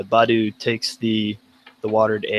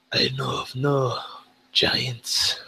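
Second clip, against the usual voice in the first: hushed dwarf reply; 2 semitones higher, 4 dB quieter, slower than usual. Acted. Player voices dwarf denial.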